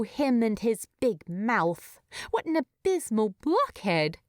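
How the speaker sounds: ordinary delivery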